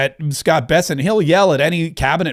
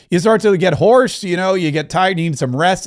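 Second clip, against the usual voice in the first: usual speaking voice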